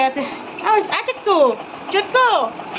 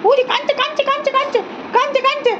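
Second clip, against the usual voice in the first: very high cooing sing-song; fond high pet voice; 9 semitones higher than usual. Real, not acted. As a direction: coo over the chicks, pitched way up. Searching for chicks, baby talk.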